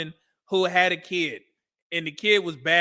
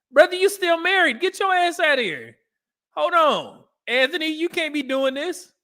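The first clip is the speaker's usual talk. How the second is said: incredulous outburst; 9 semitones higher than usual. Real, not acted. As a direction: shout at the screen in disbelief. Yelling at contestant on screen.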